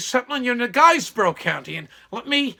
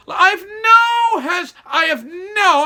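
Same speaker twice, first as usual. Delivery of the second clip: emphatic outburst; 8 semitones higher, 6 dB louder, much slower than usual. Real, not acted.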